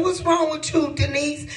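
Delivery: alarmed question. Retold or imitated. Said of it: "And then I said".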